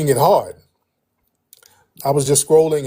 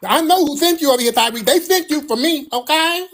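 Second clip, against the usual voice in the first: high, loud indignant impression; put-on whine, pitched way up; mock sassy whiner; 11 semitones higher, 4 dB louder than usual. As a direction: imitate a self-important sassy man. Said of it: "sassy ass dudes up in these spaces".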